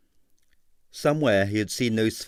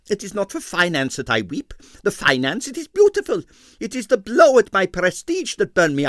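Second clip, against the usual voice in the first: excited Russian impresario; 8 semitones higher, 4 dB louder than usual. A narrator's reading. Stroganoff's accented rant.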